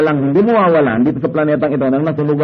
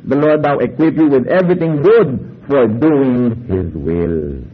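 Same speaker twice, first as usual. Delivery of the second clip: scripture reading; 3 semitones lower, slower than usual. Shown as a demonstration. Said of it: "Sabi sa Hebrews thirteen twenty-one".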